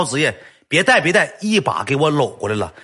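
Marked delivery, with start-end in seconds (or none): 0.7-1.3 high, loud don't-equip-it shout; mock yelled warning; retold yell. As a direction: yell at him to stop, higher and louder. Brother yelling again.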